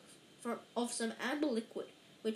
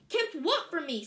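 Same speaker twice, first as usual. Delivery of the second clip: higher-pitched, eager question; 6 semitones higher, 6 dB louder than usual. A narrator's reading. Boy desperate to hear the secret, eager. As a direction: jump in keenly, pitched up. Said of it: "said Harry eagerly".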